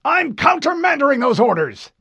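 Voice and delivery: high, loud barking admiral; barked order. Acted. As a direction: bark it down the line. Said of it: "the Admiral barked".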